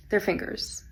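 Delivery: ordinary delivery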